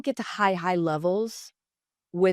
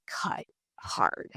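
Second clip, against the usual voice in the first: hushed whisper, slowing at the end; 4 semitones lower, 7 dB quieter than usual. Real, not acted. Telling the host how the coach's words hurt, hushed. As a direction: let the words drop to a whisper. Confessing the remark stung.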